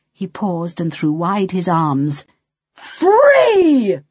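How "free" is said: high, loud grand reveal; triumphant shout. A narrator's reading. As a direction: fling out the word in triumph. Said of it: "his voice growing louder and louder".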